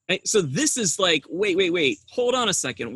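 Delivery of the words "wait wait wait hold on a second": high-pitched, incredulous interruption; mock-alarmed protest; baffled objection. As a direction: break in to halt everything, voice jumping up. Acted objection to the baptism.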